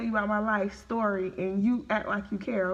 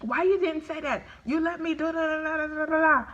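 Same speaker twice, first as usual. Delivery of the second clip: higher, rushed scolding, imitating the nail tech; fast annoyed nail tech; 7 semitones higher than usual. Retelling how the nail tech scolded her, imitated rushed scold. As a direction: rattle it off like the irritated nail tech scolding you. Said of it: "she was talking fast with me".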